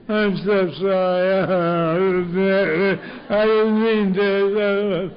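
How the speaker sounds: loud, slow, high mock wailing; hammed-up drunken blubbering apology; crying drunk. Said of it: "is a crying, sorry drunk".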